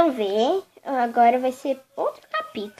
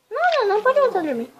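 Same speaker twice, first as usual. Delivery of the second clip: fast doll voice; 6 semitones higher, much faster than usual. Acted. Other doll answers fast.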